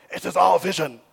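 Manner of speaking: a brief put-on Chinese accent on the quote. Pastor quoting a Chinese church leader, in a light Chinese accent. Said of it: "That's my Chinese accent now".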